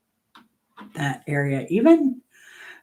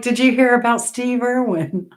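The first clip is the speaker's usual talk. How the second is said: higher, louder Australian imitation; retold Australian question, pitched up; Australian accent; 7 semitones higher, 5 dB louder than usual. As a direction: mimic an Aussie breaking urgent news. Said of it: "they're Australian".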